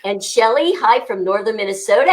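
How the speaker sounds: usual speaking voice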